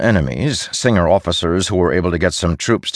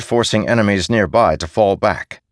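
ordinary delivery, nothing marked

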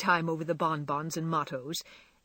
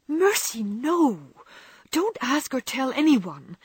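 alarmed; 7 semitones higher, 5 dB louder, slower than usual. A narrator's reading. Meg alarmed, hiding her sprained foot.

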